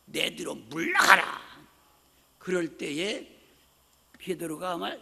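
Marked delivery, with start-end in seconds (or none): 0.0-1.4 loud commanding rebuke; Jesus rebuking Peter, loud and stern. Retold or imitated. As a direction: order him back like a master. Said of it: "소리를 지르시면서".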